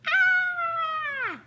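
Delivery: long, high, loud scream. Pretend. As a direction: scream like a scared skeleton. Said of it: "you have to do a scream".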